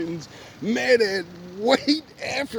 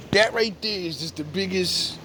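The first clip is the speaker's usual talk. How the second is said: ordinary delivery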